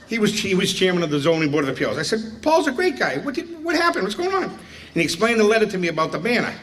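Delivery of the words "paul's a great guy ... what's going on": high, wide-eyed fake surprise; mock-innocent question, pitched high; feigned innocence. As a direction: act baffled, voice way up. Feigning innocence in retold story.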